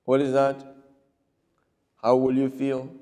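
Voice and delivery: usual speaking voice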